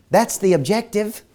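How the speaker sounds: chuckling through the words, slowly; laughing. Real, not acted. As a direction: laugh it out as you say it. Laughs while stating the goal.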